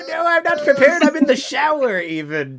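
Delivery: high, loud, rushed fake panic; mock dismay. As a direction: protest in a hammed-up high voice. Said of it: "everybody in the house is like".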